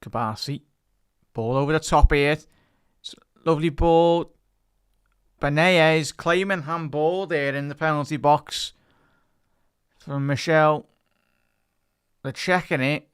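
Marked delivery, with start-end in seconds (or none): 1.3-13.1 louder, higher live call, the lovely ball stretched out slow; a touch of a TV co-commentator's excitement; excited commentary. Real, not acted. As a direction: get swept up calling the move. Excited call of attacking move.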